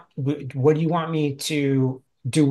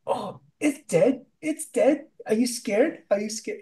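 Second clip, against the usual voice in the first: high, mocking sing-song; teasing taunt; 8 semitones higher than usual. [name] teasing the scared crew, mocking. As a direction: dangle it and tease them. [name] teases with dead monkey.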